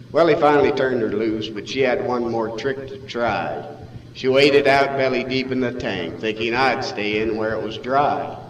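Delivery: plain reading voice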